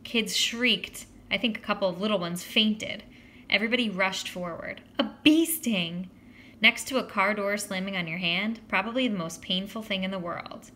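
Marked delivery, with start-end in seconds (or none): 5.0-6.1 slow, loud emphatic outburst; stretched, punchy exclamation; dramatic exclamation. A narrator's reading. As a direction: draw it out with horror. Exclaimed aghast at the sting.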